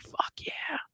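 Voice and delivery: gleeful whisper; hushed mock whisper; whispered cheer. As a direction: whisper it with secret delight. Her inner voice, whispered.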